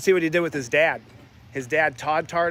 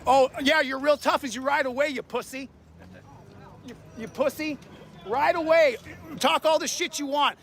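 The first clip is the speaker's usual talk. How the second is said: angry taunting; 7 semitones higher than usual. Real, not acted. Man taunts cops riding away, angry yelling. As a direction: hurl insults at them as they leave.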